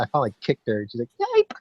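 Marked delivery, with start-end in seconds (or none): 1.2-1.5 very high, loud little yelp; imitated dog yelp. Imitating mom's terrier getting kicked, high-pitched yelp. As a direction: squeal like a kicked small dog.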